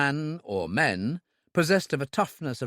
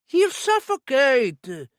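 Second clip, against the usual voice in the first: much higher, slow alarmed voice; frightened protest; 12 semitones higher, 6 dB louder, much slower than usual. A narrator's reading. Owner objects to locking Marcel in, high frightened voice. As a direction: protest in fear.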